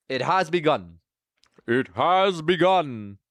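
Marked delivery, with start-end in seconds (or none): 1.7-3.2 slow, drawn-out mock-epic announcement; grandly slow, put-on voice; dramatic announcement. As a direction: stretch it out like an epic proclamation.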